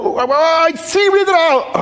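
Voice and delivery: hoch gequietschter, lauter Panikruf; äff den gestressten Zweifler überdreht nach; panischer Zweifler. Retold or imitated. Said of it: "hirnverbrennte Variante". (hoch und laut, gespielte Panik eines Zweiflers)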